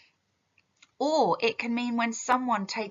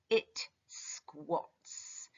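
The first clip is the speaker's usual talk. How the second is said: hushed reading; 2 semitones lower, 8 dB quieter than usual. Shown as a demonstration. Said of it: "So name flaring like a flag".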